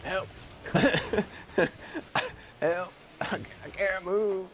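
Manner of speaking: high, strained pretend panic; mock cry for help. Player pretending to be stuck stretching, high mock distress. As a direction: squeal for help as a joke.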